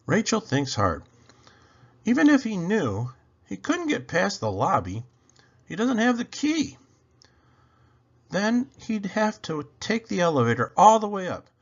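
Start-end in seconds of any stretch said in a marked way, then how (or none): 2.0-11.5 put-on high girl's voice working it out; little girl voice. A narrator's reading. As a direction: think it through as the sister, up high. Rachel voiced thinking hard.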